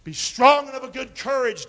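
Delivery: loud, ringing Bible read; forceful scripture reading. Acted. Pastor reading Joshua to the church, loud. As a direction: declare it like a command.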